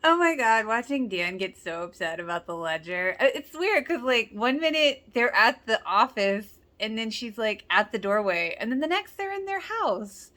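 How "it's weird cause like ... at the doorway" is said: ordinary talk, nothing marked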